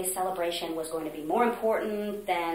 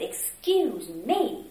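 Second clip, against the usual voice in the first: slow, drawn-out, higher stern rebuke; stern excuse me; 5 semitones higher, 5 dB louder, much slower than usual. Retold or imitated. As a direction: mimic the director's icy slow interruption. Retold stern choir director.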